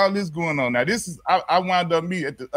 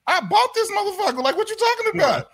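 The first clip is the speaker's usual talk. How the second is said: very high, indignant retold outburst; getting mad; 14 semitones higher than usual. Driver answering officer about the car, getting mad. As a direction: fire back, pitch shooting up. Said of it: "now I'm getting mad".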